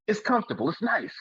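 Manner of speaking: mock-delighted squeak of approval; playful high-pitched praise. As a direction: sing the car's praises in a high voice. Acts out praising the comfy car.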